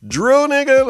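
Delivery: high and loud, scoffing at the name; incredulous shout. Real, not acted. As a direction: shout the name like it's a joke. Host repeats in disbelief.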